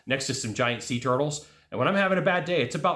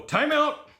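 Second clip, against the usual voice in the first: high, punchy call; calling time out; 13 semitones higher than usual. Shown as a demonstration. Showing viewers how to halt a loop, calling out. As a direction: call it out like halting play.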